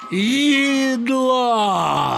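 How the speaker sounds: high, long drawn-out bellow; bawling robber. A narrator's reading. Robber demanding the family's supper, high long bawl. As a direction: bawl it out, stretching the word. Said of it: "загорланив Зубило".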